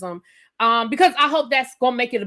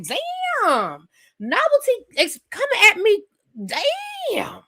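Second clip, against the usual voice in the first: mock shocked, voice pitched higher; 11 semitones higher, slower than usual. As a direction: act stunned at the rudeness. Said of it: "I was like".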